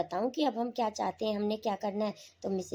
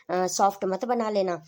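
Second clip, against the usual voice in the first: usual speaking voice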